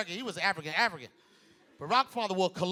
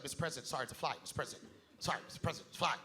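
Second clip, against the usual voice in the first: hushed, low fumbling apology; mock timid aside, barely voiced; nervous interviewer; 6 semitones lower, 11 dB quieter, much faster than usual. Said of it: "got all nervous".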